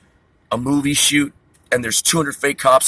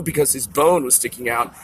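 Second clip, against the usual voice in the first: ordinary talk, nothing marked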